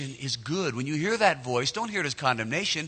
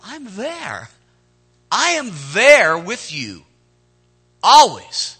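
God's assurance; 5 semitones higher, 10 dB louder, much slower than usual. Acted.

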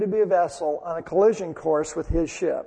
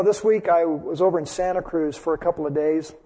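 ordinary talk

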